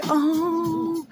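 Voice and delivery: long drawn-out, lovey-dovey sing-song; mock swooning. Retelling how he calls her Flower, mock swooning. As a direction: draw out the sweet talk, hamming it up. Mimicking flattered self and sweet-talking man.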